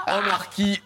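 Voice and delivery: high laugh running into the words; amused laughter. Real, not acted. Host reacting to the marquis sketch, laughing. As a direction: talk through a big laugh.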